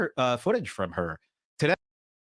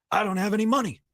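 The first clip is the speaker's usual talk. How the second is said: imitating friend; 6 semitones higher than usual. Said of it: "And he goes".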